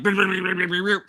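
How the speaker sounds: quick trilled babble imitating busy braiding; imitated whirring sound; rapid brr noise. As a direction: buzz through it like frantic fingers. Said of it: "she was like".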